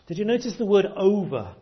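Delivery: ordinary talk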